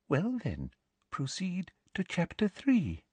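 soft, high hushed teacher voice; whispered. A narrator's reading. Teacher privately directs pupil to the next chapter, whispered. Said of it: "she bent down and whispered".

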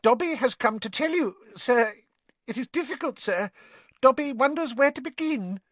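high, squeaky elf, hesitant; earnest house elf. Acted. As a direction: struggle to begin in a high little voice.

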